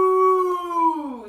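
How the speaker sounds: loud, drawn-out imitated moo; cow moo. Cow answering duck with a loud moo. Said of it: "said the cow".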